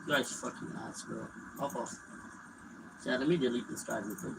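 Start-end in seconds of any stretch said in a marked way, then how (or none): 0.1-1.9 soft, slow half-whisper; muttered under breath. Real, not acted. Gamer muttering about a bad teammate, hushed. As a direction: grumble it to yourself.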